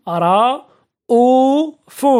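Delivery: رؤوف very slow, broken into long separate syllables. Shown as a demonstration. Teacher sounding out an example of hamza, رؤوف syllable by syllable. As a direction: stretch each syllable of رؤوف, pausing between them.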